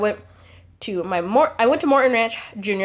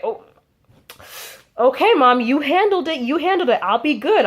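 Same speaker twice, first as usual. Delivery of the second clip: high-pitched, placating reply; lightly acted, hasty reassurance; eagerly agreeable; 4 semitones higher than usual. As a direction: back off and let mom handle it. Acts out backing down to mom.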